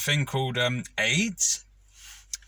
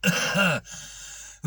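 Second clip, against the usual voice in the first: fake cough; 6 semitones higher than usual. Faking illness to the boss.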